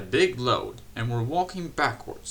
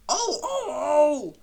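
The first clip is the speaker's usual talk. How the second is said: very high, louder startled oh; shocked cry; 6 dB louder, slower than usual. A narrator's reading. Henry is hit by stones, high startled cries.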